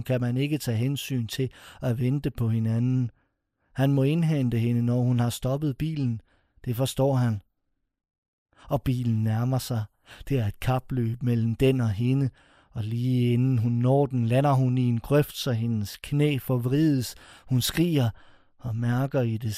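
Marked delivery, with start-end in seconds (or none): none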